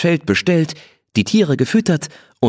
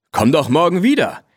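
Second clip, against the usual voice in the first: higher call after someone leaving; 8 semitones higher than usual. Acted. Blackie calling after Rose as she leaves, calling out higher. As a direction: call out after her, a bit lonely. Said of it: "rief Blackie hinterher".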